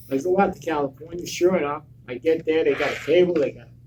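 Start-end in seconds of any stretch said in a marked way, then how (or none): none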